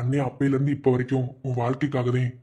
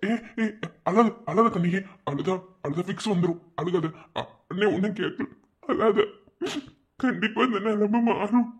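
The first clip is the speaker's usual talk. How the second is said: higher, soothing reassurance; comforting; 6 semitones higher than usual. Acted. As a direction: hush her gently like a brother. Brother comforting crying sister. (அழும் தங்கையை அண்ணன் தேற்றுதல்)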